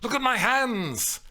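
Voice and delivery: high, loud indignant cry; high-pitched heated outburst; agitated demand. A narrator's reading. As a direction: make him look, upset. Scathing woman continues quarrel.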